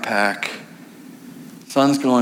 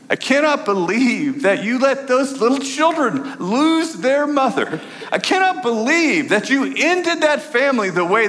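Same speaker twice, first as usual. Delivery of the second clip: much higher, pleading outcry to God; anguished reproach; 11 semitones higher than usual. Retold or imitated. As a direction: pour out raw grief at God. Pastor confronting God angrily.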